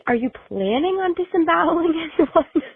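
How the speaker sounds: laughing through the question; a real laugh breaking into 'disemboweling'; half-laughing, pitch up high. Real, not acted. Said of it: "I have to laugh 'cause you just have to laugh".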